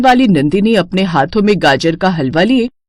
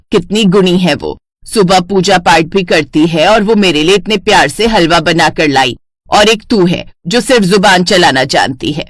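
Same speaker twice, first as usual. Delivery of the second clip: taunting mother-in-law; 2 semitones lower than usual. Acted.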